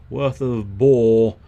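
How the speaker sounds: booming, slowed "worth of boar"; loud relish. Real, not acted. Gamer booming his weapon pick slowly. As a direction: lean into it with glee.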